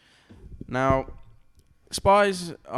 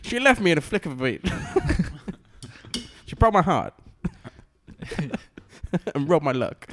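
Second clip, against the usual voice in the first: higher, hammed-up noir storytelling; playful noir romance narration, pitched up; mock noir narrator; 4 semitones higher than usual. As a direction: recall a femme fatale in melodramatic voice-over. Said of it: "A noir thing".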